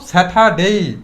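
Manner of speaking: স্যাটারডে with no r, ending in a long "dei"; silent r. Shown as a demonstration. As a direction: leave out the r in স্যাটারডে, stretch the last syllable. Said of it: "স্যাটারডে না বলে আমরা কি বলবো". (স্যাটারডে-র "র" নীরব, শেষে টানা "ডেই")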